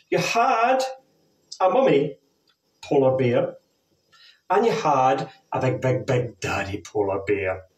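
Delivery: put-on big storybook telling to kids; slow sing-song story for little ones; reading to children.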